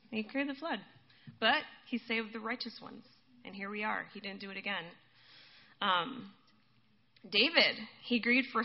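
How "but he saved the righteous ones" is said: ordinary talk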